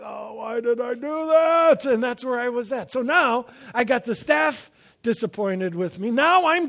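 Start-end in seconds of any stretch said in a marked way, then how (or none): 0.0-1.9 hammed-up regret; regretful groan. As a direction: moan it like instant regret after dessert. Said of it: "you're just like".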